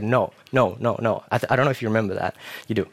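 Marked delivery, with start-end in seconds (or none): none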